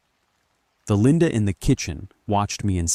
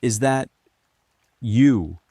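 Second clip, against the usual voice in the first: higher, halting question with a pause; disbelief; 5 semitones higher, 5 dB louder, slower than usual. A narrator's reading. Narrator faces an enraged Linda, disbelieving. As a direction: ask haltingly, unable to believe it. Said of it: "asked again in disbelief".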